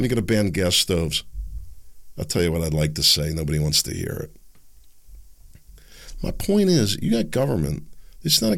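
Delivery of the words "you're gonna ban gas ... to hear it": ordinary talk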